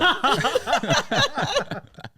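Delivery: loud high laugh. Real, not acted.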